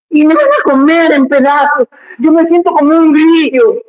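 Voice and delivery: put-on high, whimpering child's excuse; hammed-up high child whine; whiny son. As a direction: play a scared whining kid. Whining son in an acted dialogue.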